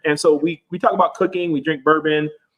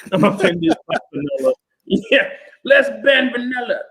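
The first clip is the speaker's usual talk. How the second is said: mock offended; 7 semitones higher than usual. Mocking outraged people.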